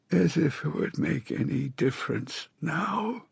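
high, slow hoarse voice of a sick woman; a dying woman's rasping plea. A narrator's reading. Narrator voices dying Astrid.

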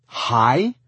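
loud, high, indignant echo; indignant huff. Acted. Lizzie throws Tristan's greeting back, in an indignant huff. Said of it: "she huffed".

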